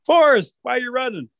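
high-pitched urgent holler; calling out. Acted. Rabbit catches up with the forest, calling out. Rabbit calls to the running trees.

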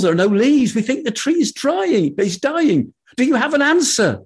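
high eager villager voice; excited high townsman. Acted. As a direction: gush with hope, fast and high.

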